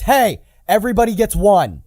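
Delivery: mock-scolding superhero comeback; Spidey's retort. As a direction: punch it out loud and high. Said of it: "looks him dead in the face and says".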